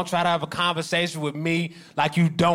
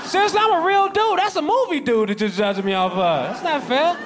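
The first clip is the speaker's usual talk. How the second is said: whiny plea; 11 semitones higher than usual. Real, not acted. Pleading high complaint.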